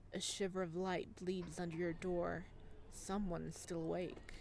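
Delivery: ordinary delivery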